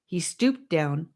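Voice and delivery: plain reading voice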